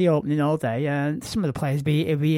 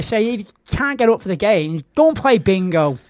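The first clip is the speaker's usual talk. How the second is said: ordinary talk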